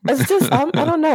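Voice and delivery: normal talking voice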